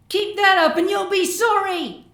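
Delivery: put-on high, loud childish shriek. A narrator's reading. Young Sunset yelling at the schoolyard bullies, high shriek. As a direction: scream it like a cornered little filly.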